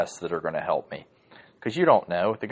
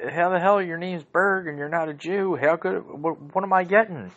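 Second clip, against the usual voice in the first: high, whiny mock outrage at the lawyer's name; indignant Larry David; 9 semitones higher than usual. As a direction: play a baffled, complaining client, pitched up. Voicing Larry David's indignant complaint.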